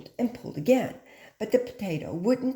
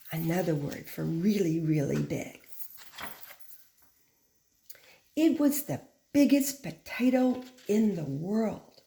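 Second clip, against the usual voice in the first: put-on bright, lively read-aloud to children; storytime reading; 3 semitones higher than usual. A narrator's reading. Teacher reading the tale to her class, lively storytime.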